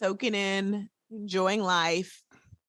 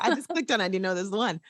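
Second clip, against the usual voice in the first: normal talking voice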